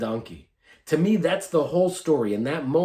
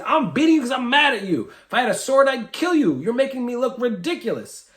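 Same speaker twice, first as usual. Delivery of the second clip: high, loud outburst of rage; furious Balaam; 10 semitones higher, 3 dB louder than usual. Acted. Balaam threatening his donkey, loud and furious. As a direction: threaten the donkey like you would kill it.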